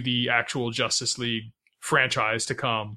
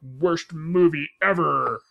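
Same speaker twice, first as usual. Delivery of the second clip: slow, smug critic impression; drawn-out, self-important mock verdict; Comic Book Guy; much slower than usual. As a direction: draw out each word like a snobby critic. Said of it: "that comic book guy stereotype from The Simpsons".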